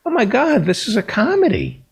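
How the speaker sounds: high-pitched, astonished outburst; retold amazed realization. Host retelling the moment he got the joke watching with his mother, amazed. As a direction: exclaim it like a sudden hilarious discovery.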